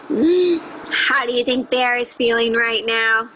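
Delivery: normal talking voice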